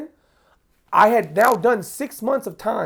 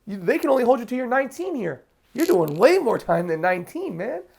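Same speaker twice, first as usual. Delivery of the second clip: blunt public defender; 6 semitones higher than usual. Retold or imitated. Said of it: "He's like".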